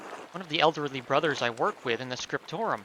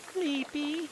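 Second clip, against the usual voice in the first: put-on high, small child character; child's voice; 10 semitones higher, 5 dB quieter than usual. Child answering a guest, high little-kid voice.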